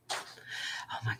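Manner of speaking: slow, breathless whisper; hushed under the breath. Real, not acted. Cat returns, hushed reaction.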